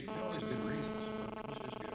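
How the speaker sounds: high, sarcastic mock of war boosters. Mocking war justifications.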